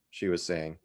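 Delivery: ordinary delivery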